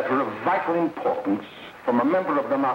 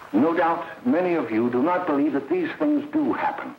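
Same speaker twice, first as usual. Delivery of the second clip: usual speaking voice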